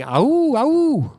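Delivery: high rising amateur howl; a modest stab at a wolf's howl; mock wolf howl. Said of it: "mimic the sound of a wolf going".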